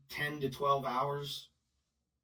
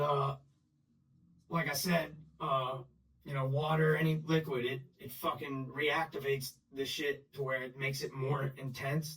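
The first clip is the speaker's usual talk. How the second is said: normal talking voice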